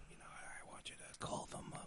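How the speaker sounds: hushed, confidential whisper; secretive whisper. Acted. Partner whispers a secret pet name to the kids, whispered. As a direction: whisper it like a private little secret.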